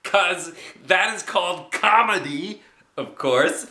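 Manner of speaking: laughing through high narration; amused child. Acted. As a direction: giggle as you tell it. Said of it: "I laughed".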